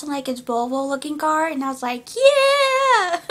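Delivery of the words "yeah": high, loud whoop dissolving into laughter; gleeful "yeah"; excited cheer. Retold or imitated. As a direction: draw it out and cheer like a thrilled fan. Retold own excited cheer.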